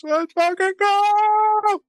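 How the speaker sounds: ecstatic shout. Real, not acted. Hyping a wrestling challenge on the podcast, an excited drawn-out shout. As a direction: stretch it out and bellow it like a fan losing it. Hyped about a title challenge.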